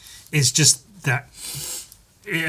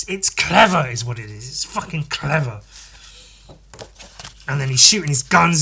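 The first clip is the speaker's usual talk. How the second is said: usual speaking voice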